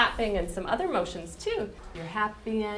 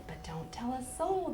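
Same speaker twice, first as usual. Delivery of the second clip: hushed; 10 dB quieter than usual. Acted. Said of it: "I'll whisper a secret".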